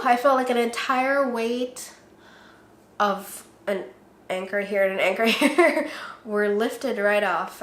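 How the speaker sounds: ordinary delivery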